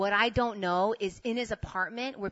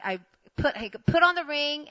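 plain reading voice

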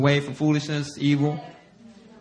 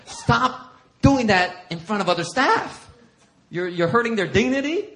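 higher-pitched imitation of a scolding; wife's rebuke; 6 semitones higher, 4 dB louder than usual. Wife rebuking pastor about staff, mock scolding. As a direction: drag out the first words in mock reproof. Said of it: "Erin has rebuked me for this".

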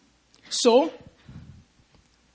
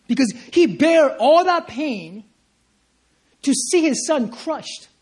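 slow, weighty; 5 semitones higher, 4 dB louder, slower than usual. Real, not acted. Preacher slows on the cross.

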